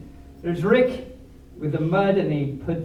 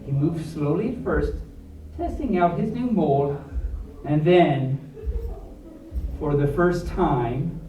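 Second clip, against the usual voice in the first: put-on reading to children; 4 semitones lower than usual. A narrator's reading. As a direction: build the suspense for the kids. Reads picture book aloud.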